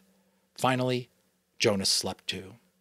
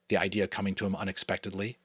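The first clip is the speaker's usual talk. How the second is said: usual speaking voice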